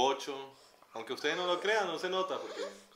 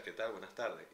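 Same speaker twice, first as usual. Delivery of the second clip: put-on neutral Caracas accent, a touch softer; 5 semitones lower, 7 dB quieter than usual. Greeting customers at the ticket desk, in a neutral accent. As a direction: drop the regional accent, plain neutral Spanish. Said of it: "notaba el acento neutro".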